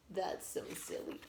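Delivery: plain reading voice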